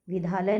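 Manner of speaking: विद्यालय without its y, "vidhaalay"; mispronounced विद्यालय. Shown as a demonstration. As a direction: drop the y glide from विद्यालय. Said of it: "नहीं". (विद्यालय का गलत उच्चारण, "विधालय")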